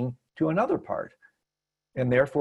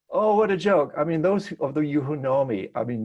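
usual speaking voice

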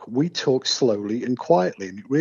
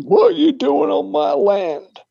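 angry armed landowner; 6 dB louder than usual. Retold or imitated. Retold man with gun.

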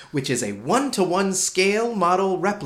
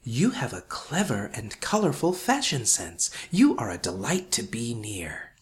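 soft, low whispered compliment; murmured forest voice sinking into a whisper; 6 semitones lower, 5 dB quieter than usual. Retold or imitated. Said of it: "It also whispered".

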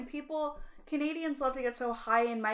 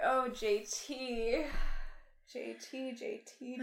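fond chiding; 3 semitones higher than usual. Retold or imitated. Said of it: "I was like".

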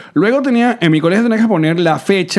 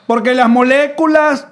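higher and slow, spelling out every word; teacher dictating; 7 semitones higher, 3 dB louder, slower than usual. Retold or imitated. S1 mimicking a teacher dictating to the class, slow and high. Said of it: "el profesor comenzara".

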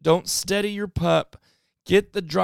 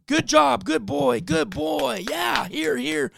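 high, happy praise for the dog; excited praise; 8 semitones higher, slower than usual. Shown as a demonstration. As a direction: gush praise at the pup and call him in. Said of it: "then praise him".

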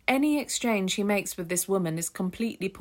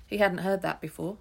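ordinary delivery

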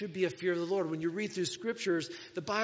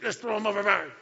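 raised, hammed-up team cry; mock rallying shout; 3 semitones higher, 6 dB louder than usual. Imagined sailors about to toss Jonah, mock rallying shout. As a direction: call it out to the crew.

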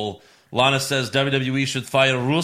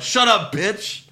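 higher-pitched trash-talk imitation; mock angry retort; 7 semitones higher, 3 dB louder, slower than usual. Host retells Owens answering trash talk, mock anger. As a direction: snap back like a wrestler mid-hold. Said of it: "then Owens replied".

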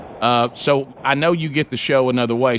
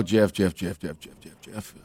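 fading whisper; 7 semitones lower, 6 dB quieter, slower than usual. Acted. Caller missed by one call.

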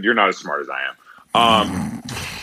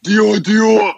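much higher, louder rapper ad-lib imitation; 12 semitones higher, 5 dB louder than usual. Joking to co-host after talk of the voice, rapper impression. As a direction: burst out a mock rap hook. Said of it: "about to do [name] voice".

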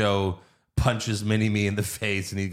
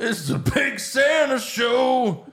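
announcer shout; 12 semitones higher, slower than usual. Retold or imitated. Announcing Big Show as Santa.

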